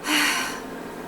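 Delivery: long audible test breath; breath check. Shown as a demonstration. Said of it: "And then I would go".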